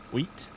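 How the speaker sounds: "Wheat" with the end T released; pronounced final T. Shown as a demonstration. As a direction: try "Wheat" carefully, finishing on an audible T. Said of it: "Do they just pronounce the T?".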